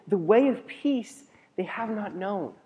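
slow, measured reading; slow solemn reading; solemn scripture. Acted. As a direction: lay the verse down gravely.

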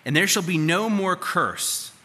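loud, ringing Bible reading; scripture reading. Acted. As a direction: proclaim the verse to the hall. Reads Scripture aloud at volume.

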